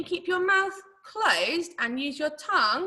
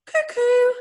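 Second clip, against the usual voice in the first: high, loud two-note cuckoo; high-pitched cuckoo imitation; cuckoo bird call; 12 semitones higher, 7 dB louder than usual. Shown as a demonstration. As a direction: pipe up like a clock's little bird. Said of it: "Can you copy my sounds?".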